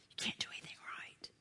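quick hushed whisper of a lie; devil's whisper. Acted. The devil whispering into the ear, fast and hushed. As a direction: hiss the lie softly and fast. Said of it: "the lies he whispers in your ear".

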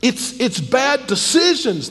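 fiery preacher, high and loud; preaching. Acted. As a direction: preach it hard from the pulpit. Preaching at full force.

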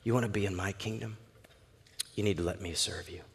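low, hushed explanation; Jesus, quietly firm. Acted. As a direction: say it very softly and gravely. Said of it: "What's Jesus saying?".

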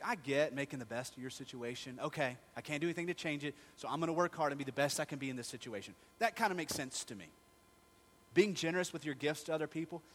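normal talking voice

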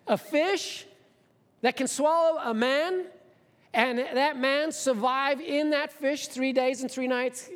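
high, slow disbelief; incredulous. Real, not acted. Preacher voices the doubters' disbelief, high and slow. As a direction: ask it as if it's absurd. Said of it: "let's get serious now".

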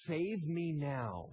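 "Save me now" drawn out slowly; solemn slow plea "Save me now"; slow, weighted "Save me now". Shown as a demonstration. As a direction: lean on "Save" and stretch each word. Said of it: "the word actually means".

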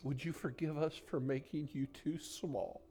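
soft, reverent praying with long pauses; quiet, pleading pastoral prayer; hushed prayer. Real, not acted. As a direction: speak intimately to God, nearly whispering at times. Leads the congregation in prayer.

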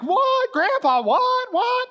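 put-on high, loud disbelieving kids; shocked grandkids. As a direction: cry out like astonished grandchildren. Grandkids reacting to shocking story.

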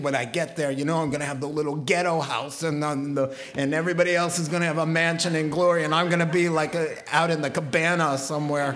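fast, rushed complaining; hammed-up worried believer, laughing at the end; mock worry. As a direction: rattle off the fear of a small house.

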